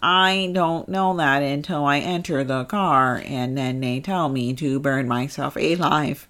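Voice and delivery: slow, measured mock robot; put-on robot explaining itself word by word. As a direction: answer slowly as a programmed machine. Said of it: "robot, when you're gonna go set yourself".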